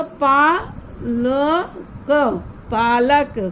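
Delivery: slow, letter by letter, then the blended word; sounding out syllables. Shown as a demonstration. Teacher sounds out each letter for young pupils, slowly. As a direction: spell it out slowly for children. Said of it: "teacher sounds out syllables".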